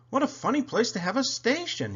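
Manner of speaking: high little peeping voice; high, light toy-engine voice. A narrator's reading. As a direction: peep it like a small curious engine. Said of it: "he peeped".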